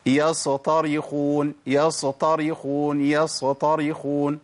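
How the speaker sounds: يصطرخون with an extra vowel after the ص; voweled sad mistake. Shown as a demonstration. Teacher showing a common mistake, sad wrongly given a vowel. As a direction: slip a short vowel onto the silent ص, chanting slowly. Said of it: "إن أنا وهي ساكنة أحركها".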